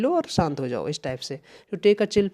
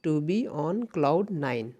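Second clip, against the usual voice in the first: slow dictation; 3 dB quieter, much slower than usual. Shown as a demonstration. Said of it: "याद रखिएगा".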